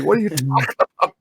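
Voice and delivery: quick, baffled what are you talking about; baffled retort. Retold or imitated. Woken partner answers, fast and baffled. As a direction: fire it back, confused.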